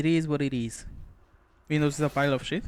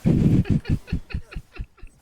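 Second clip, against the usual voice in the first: sudden high-pitched burst of laughter; high loud laugh; 6 dB louder than usual. Real, not acted. Streamer cracking up at chat, high loud laugh. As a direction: let a big squeaky laugh burst out.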